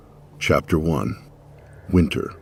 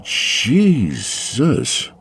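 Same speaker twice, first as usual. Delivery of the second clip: startled gasp; 6 semitones higher than usual. Acted. Startled by blue eyes.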